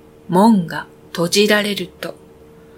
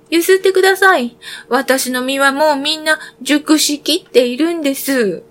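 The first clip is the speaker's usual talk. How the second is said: much higher and louder, insistent; a tree calling out at full voice; 6 semitones higher than usual. Acted. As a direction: shout the plea even harder. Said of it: "木が大声で呼びかけました".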